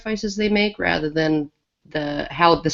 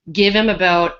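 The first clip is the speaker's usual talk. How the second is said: normal talking voice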